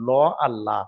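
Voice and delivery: ল with a rounded mouth, then লা with an open, thin sound; heavy and light l. Shown as a demonstration. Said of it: "পুর আর বারিক". (পুর ল আর বারিক লা পাশাপাশি)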